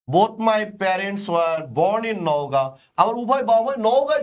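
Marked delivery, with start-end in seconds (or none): 0.1-2.7 very slow, even and deliberate; slow dictation. Shown as a demonstration. Teacher reading the model sentence slowly. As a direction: read the sentence out slowly for students. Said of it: "তাই আমি বললাম".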